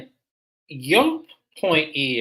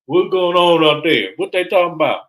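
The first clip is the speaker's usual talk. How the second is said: mock clueless husband; 4 semitones higher, 6 dB louder than usual. Voicing Bubba, clueless.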